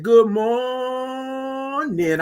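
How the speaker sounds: slow, high stretched "Good morning"; singsong stretched "Good morning"; drawn-out good morning. Retold or imitated. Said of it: "all those O's and R's".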